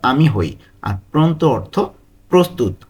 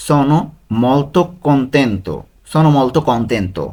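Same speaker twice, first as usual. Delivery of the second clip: slow model pronunciation; much slower than usual. Shown as a demonstration. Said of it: "teacher models Italian phrase".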